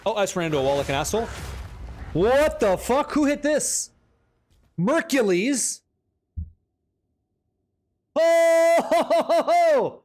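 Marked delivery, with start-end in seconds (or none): 2.1-10.0 high, slow disbelieving what the fuck, rising to a loud Oh; real shock at someone's lucky drop; stunned surprise. Real, not acted. Streamer reacting to a viewer's huge drop, stunned.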